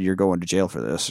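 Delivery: low, quiet and calm. Shown as a demonstration. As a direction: say it evenly, no yelling. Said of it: "could have just said".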